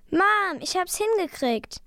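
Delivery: high, delighted call; high-pitched proud exclamation; excited triumph. A narrator's reading. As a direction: announce your success with excitement. Child triumphantly calls to mother.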